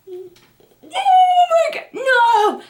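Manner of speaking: very high mock-panicked wail; re-enacted panicky squeal; freaked out. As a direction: draw it out in alarm. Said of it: "So I freaked out".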